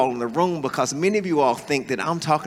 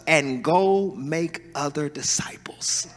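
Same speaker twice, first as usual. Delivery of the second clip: slow, softer whispered command; hushed quote; 2 semitones lower, 6 dB quieter, slower than usual. Acted. Jesus giving the first call to preach, soft whisper. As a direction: breathe the words softly.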